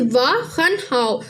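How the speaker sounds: slow, deliberate word-by-word glossing; walk the students through each word slowly; patient teacher breaking a sentence into words; slow teaching. Shown as a demonstration. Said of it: "फिर इसी तरह से".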